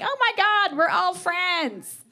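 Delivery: high, slow, starry-eyed gush; giddy twentysomething roommate. Retold or imitated. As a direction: gush like a young roommate thrilled by everything. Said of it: "It's like".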